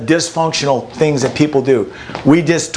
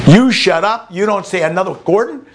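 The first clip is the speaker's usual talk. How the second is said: scolding mom; 5 semitones higher than usual. Retold or imitated. Mother yelling at her twelve-year-old son. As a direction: snap at the kid like an angry mother.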